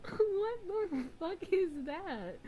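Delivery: high, loud, slow, laughing through it; laughing disbelief. Real, not acted. Streamer laughing at her own thumbnail, high disbelief. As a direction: squeal it mid-laugh.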